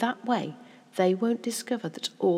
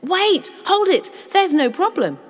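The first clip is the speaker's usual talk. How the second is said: loud, high, sudden exclamations; urgent, excited outcry; crying out; 13 semitones higher, 13 dB louder than usual. A narrator's reading. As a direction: shout it as an idea strikes. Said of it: "Then he cried out".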